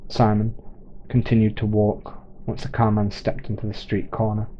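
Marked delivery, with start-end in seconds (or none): none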